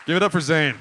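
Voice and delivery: rally the crowd to clap; calling for applause. Real, not acted. Calls for applause.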